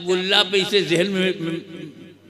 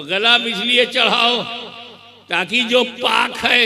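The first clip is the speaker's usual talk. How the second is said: high, loud pulpit voice building the story; fiery preaching; 8 semitones higher, 8 dB louder than usual. Acted. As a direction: preach it to the crowd at full force.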